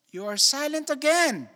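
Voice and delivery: high, loud teacher-like scold, all in jest; hammed-up plea for an answer; mock scolding. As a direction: pretend to scold the silent congregation. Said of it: "I'm just kidding".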